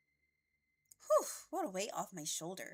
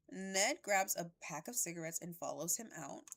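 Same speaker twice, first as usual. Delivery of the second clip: usual speaking voice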